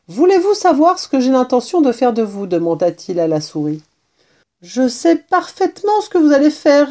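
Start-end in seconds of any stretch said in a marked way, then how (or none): 0.1-2.5 higher, keen kitten question; eager question. A narrator's reading. Kitten asking his captive mouse, eager and higher. 4.6-6.9 high, plaintive resignation; weary mouse. A narrator's reading. Captive mouse answering the kitten, high and weary. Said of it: "répondit la souris d'un air las".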